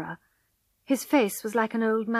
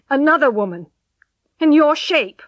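loud, raised, emphatic verdict; forceful rejection; 5 semitones higher, 11 dB louder than usual. A narrator's reading. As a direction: pronounce it hard and loud. Husband rejects his wife.

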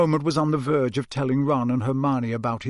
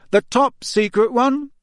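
slow, pointed, higher and louder; raised voice; 9 semitones higher, 5 dB louder than usual. Acted. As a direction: say it loudly so others hear. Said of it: "He raised his voice slightly".

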